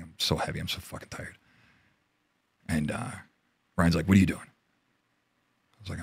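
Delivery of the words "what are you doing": sharp retold demand. Team leader demanding, retold.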